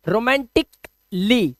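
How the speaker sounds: रोमांटिकली slowly, "romantik... lee"; broken-down pronunciation. Shown as a demonstration. Teacher breaks down रोमांटिकली for class as "romantik, lee", slowly pronounced. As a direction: split रोमांटिकली just before the final "lee". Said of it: "तुरंत बोल दो ली".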